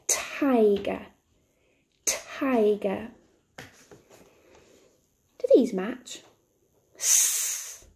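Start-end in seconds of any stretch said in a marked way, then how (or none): 0.1-3.1 "Tiger" drawn out slowly for listening; nice and slowly. Shown as a demonstration. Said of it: "nice and slowly". 7.0-7.8 S as a long voiceless hiss; phonics sound demo. Shown as a demonstration. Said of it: "What sound can you hear".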